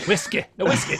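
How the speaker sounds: "Whiskey" loud and high, in a put-on accent; character accent practice. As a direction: find the accent through one loud key word. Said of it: "that's what it sounded like".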